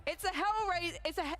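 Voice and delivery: hammed-up hero voice line; game voice line. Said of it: "one of the voice lines could be".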